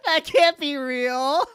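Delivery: loud, drawn-out, high laughing cry; laughing disbelief. Real, not acted. Host reacting to the clip in laughing disbelief.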